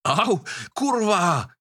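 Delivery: pained roar. A narrator's reading. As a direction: bellow as the hand hits the road. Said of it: "zařval". (bolestivé zařvání)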